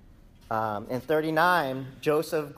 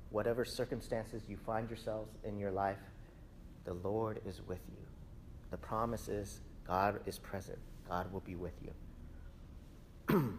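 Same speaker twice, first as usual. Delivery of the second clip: reassuring; 3 semitones lower, 10 dB quieter than usual. Real, not acted. Reassuring the congregation.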